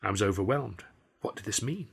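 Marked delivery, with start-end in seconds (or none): none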